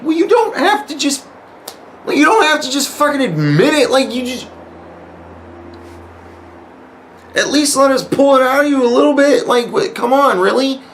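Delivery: very high, incredulous pleading; exasperated protest. Retold or imitated. Player objecting to an instant confession, exasperated. Retold complaint at too-easy confession.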